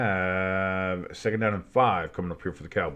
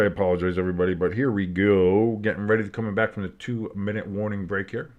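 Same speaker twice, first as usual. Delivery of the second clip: ordinary delivery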